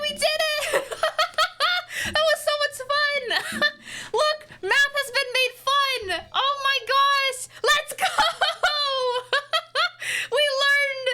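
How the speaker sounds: high, laughing cheers of victory; ecstatic squealing. Real, not acted. Streamer to chat after the calculator confirms, squealing with joy. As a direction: squeal with joy over the answer.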